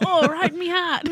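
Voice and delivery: high, loud mock Australian cry, laughing; Steve Irwin. Co-host acts out the stingray strike, mock Australian accent. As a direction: cry out in a hammed-up Aussie accent. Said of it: "My name's Steve Irwin".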